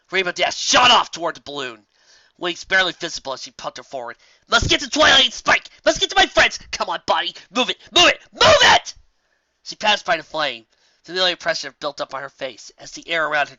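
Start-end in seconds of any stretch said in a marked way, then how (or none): 4.5-8.9 high, loud, pushing harder each time; straining shout. Acted. Rainbow Dash racing the flames to her friends, rising strained shout. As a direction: drive yourself faster, rising into a scream.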